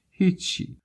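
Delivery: put-on high little voice of a tearful boy. A narrator's reading. Crying boy brushing off a stranger's question, tearful high voice. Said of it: "صدای گریهٔ پسرک بلند شد".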